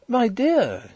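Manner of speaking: high, startled exclamation; shocked cry. A narrator's reading. Husband protests to his wife, high startled cry. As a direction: protest in surprise, pitch leaping up. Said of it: "he cried".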